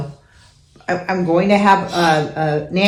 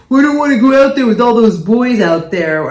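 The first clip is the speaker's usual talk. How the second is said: a little louder, lightly voiced whining excuse; mock daughter excuse; 4 semitones higher, 5 dB louder than usual. Mom imitating her daughter's excuse about the boys, light whine. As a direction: play the sulky kid making excuses.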